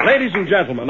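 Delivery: ordinary talk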